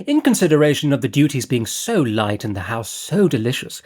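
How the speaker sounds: breezy, scatterbrained noblewoman chatter; Lady Fareway. Acted. Narrator voices Lady Fareway.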